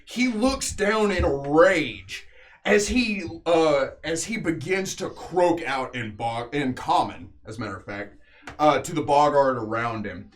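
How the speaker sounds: dramatic battle narration, leaning on the big action words; game master narration. A narrator's reading. Game master telling players of the beast's fall, dramatic narration. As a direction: tell the killing blows like an epic tale.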